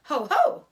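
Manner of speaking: loud scornful ho-ho laugh; a hammed-up jeering laugh; mock ho ho laugh. Rat jeers at Wilbur with a loud ho-ho.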